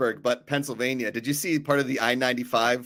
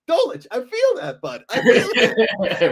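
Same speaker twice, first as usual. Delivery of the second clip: squeaky high laughing voice, slowed down; mock high-pitched reply; 7 semitones higher, 5 dB louder, slower than usual. Host jokingly answering a friend, in a silly high laughing voice.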